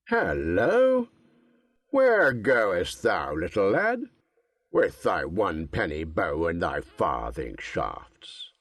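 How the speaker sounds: calling out through a mouthful; slow mocking forester, mouth full. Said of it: "with his mouth full, called out to Robin".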